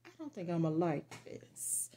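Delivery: soft, breathy whisper of a thought; a private whispered aside about a bad product; whispered doubt. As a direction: whisper it to yourself like a secret misgiving. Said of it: "and I was like".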